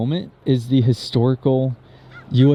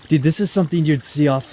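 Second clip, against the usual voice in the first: ordinary talk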